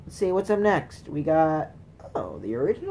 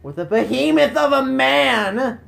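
loud, high theatrical proclamation; hammed-up announcer shout; grand boss announcement; 7 semitones higher, 6 dB louder than usual. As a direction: announce the boss with mock drama.

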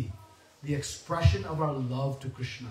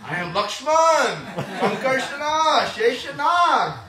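proud claim; 7 semitones higher, 5 dB louder than usual. Acted. Balram boasting to Yashoda.

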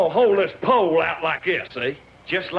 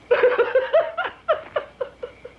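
high-pitched laughing fit; cracking up. Real, not acted. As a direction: burst out giggling at your own joke. Cracks up telling his joke.

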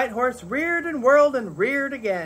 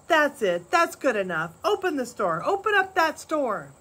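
higher, raised group shout; the crowd yelling at Pa to open the store; 6 semitones higher than usual. A narrator's reading. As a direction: egg each other on to open it. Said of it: "Then the whole crowd yelled".

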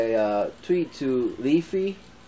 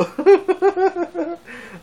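high laugh; 5 dB louder than usual. Real, not acted. Vlogger laughing at his own single joke, high burst.

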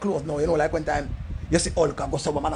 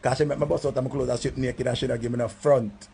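ordinary talk, nothing marked

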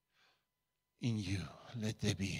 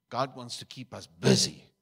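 much louder, punching God; emphatic repeat; 4 semitones higher, 11 dB louder than usual. Real, not acted. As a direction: preach the repeat with full force.

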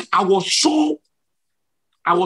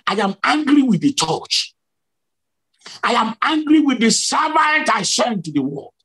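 raised, imitated rebuke; angry divine voice; 6 semitones higher than usual. Preacher voices Jesus against church and servant, high retold anger. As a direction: pronounce it like God rebuking, pitch lifted. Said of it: "cover me from his anger".

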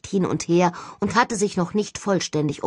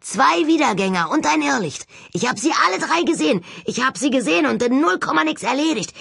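higher, fast, eager boasting; excited; 8 semitones higher than usual. Acted. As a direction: rattle it off, thrilled. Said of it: "verkündete er aufgeregt".

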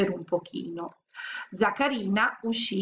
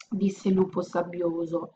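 ordinary delivery, nothing marked

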